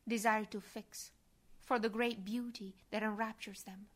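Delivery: normal talking voice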